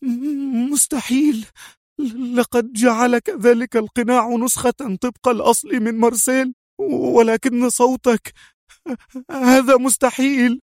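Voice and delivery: high woman's voice in shocked disbelief; high voice, frightened astonishment; trembling voice. Acted. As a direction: gasp it out, high and stunned. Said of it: "في صوت مرتجف".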